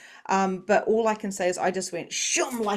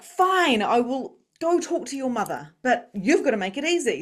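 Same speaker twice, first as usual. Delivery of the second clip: higher, louder retort to a spirit; exasperated giving-in; 6 semitones higher, 4 dB louder than usual. Retold or imitated. Retelling her talk out loud with spirit, exasperated. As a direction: give in with exasperation, pitched high. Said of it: "having these, these conversations out loud".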